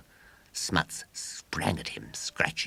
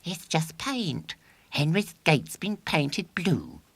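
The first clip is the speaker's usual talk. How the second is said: feeble critic; 4 dB louder, slower than usual. A narrator's reading. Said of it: "said one critic feebly".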